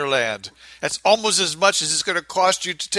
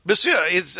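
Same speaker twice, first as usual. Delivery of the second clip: normal talking voice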